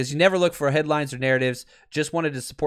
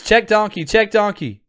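louder, punchy call of "Check donkey"; calling out the play; 5 semitones higher, 5 dB louder than usual. Retold or imitated. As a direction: bark the call like a coach on the sideline.

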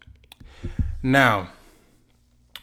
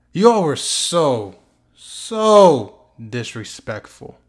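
slow, louder, indignant stress on so, so disrespectful; drawn-out emphasis on so; 6 dB louder, much slower than usual. Real, not acted. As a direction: lean hard on so disrespectful. Indignant rant at the analysts.

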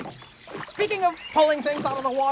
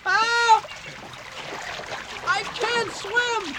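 panicked cry; 10 semitones higher, much slower than usual. Acted. Drowning in the water.

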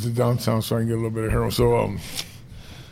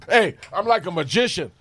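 lightly put-on boast, pitched higher; a higher mock voice bragging about skills; skills boast impression; 4 semitones higher than usual. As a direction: imitate a man talking up his skills. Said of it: "this is you talking about your skills".

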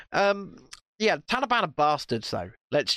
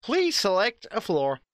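imitating lift voice; 3 semitones higher than usual.

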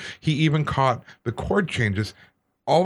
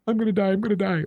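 high, squeaky mock terror; hammed-up whimper of certain doom; comic high-pitched panic; 10 semitones higher, faster than usual. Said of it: "And I'm sitting on the side going".

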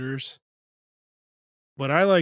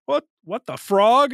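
put-on loud, higher-pitched, thick Chicago accent; exaggerated Chicago accent, pitched up; 10 semitones higher, 9 dB louder than usual. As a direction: lay on the most Chicago accent possible. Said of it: "in the most Chicago accent possible".

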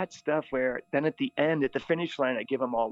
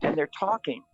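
ordinary talk

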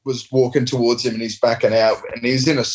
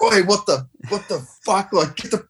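high-pitched indignant outburst; much higher, indignant mock yell; retold confrontation; 8 semitones higher than usual. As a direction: act out startling and challenging an intruder. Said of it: "Like, going at him".

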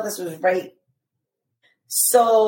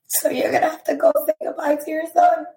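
crying while retelling; 7 semitones higher, faster than usual. Real, not acted. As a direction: voice breaks into real tears on the words. Nurse's news retold, voice breaking.

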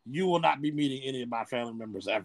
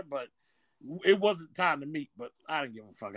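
ordinary talk

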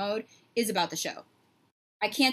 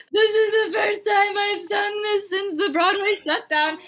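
emotional high retelling; 11 semitones higher, 8 dB louder than usual. Retold or imitated. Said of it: "I was like".

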